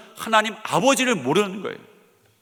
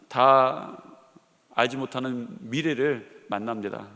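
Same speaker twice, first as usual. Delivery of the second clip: ordinary talk, nothing marked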